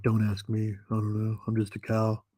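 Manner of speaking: lightly voiced, indifferent cow; a hint of a deadpan cow voice; talking cow. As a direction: answer as a cow who has no idea. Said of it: "Cow's like".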